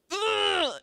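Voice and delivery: high strained groan of worry; frustrated groan. Retold or imitated. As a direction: let out a high exasperated groan. Acting out getting worried and mad.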